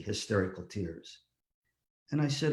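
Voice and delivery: ordinary delivery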